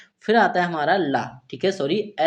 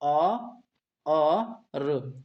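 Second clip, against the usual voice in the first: sounding out syllables; 6 dB quieter, much slower than usual. Shown as a demonstration. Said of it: "तो इसे हम क्या पढ़ेंगे".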